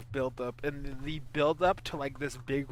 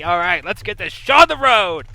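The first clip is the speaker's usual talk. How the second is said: loud, higher-pitched hype call; rallying shout; 5 semitones higher, 16 dB louder than usual. Real, not acted. Host kicking off the show, loud rallying cry. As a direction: belt it out to get things going.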